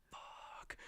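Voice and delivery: voiceless, sinking whisper of dismay; whispered dread. Retold or imitated. Boy hearing the girl may have herpes, whispered dread. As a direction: whisper it, stricken. Learning about possible herpes.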